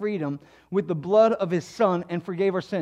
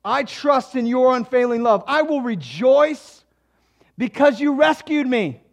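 trusting resolve; 6 semitones higher, 9 dB louder than usual. Acted. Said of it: "He said".

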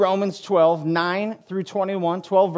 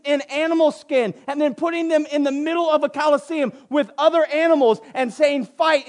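high-pitched, insistent sermon build; impassioned preaching; 8 semitones higher than usual. Real, not acted. Preacher tells the congregation of Nero's cruelty, preaching with urgent force. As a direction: hammer each atrocity home from the pulpit.

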